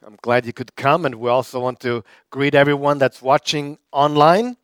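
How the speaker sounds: usual speaking voice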